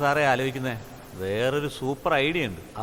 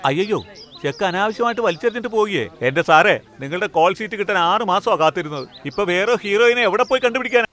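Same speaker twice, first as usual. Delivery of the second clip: high, loud dismay building higher; panicked wailing complaint; 5 semitones higher, 5 dB louder than usual. Acted. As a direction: protest frantically as the plan collapses. Director panics as heroine quits. (പരിഭ്രാന്തിയോടെ ഉറക്കെയുള്ള പരാതി)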